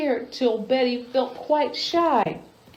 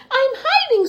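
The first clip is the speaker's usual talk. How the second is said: high, tiny frightened bird voice; scared little bird. Acted. Baby bird hiding from Betty, in a high timid voice.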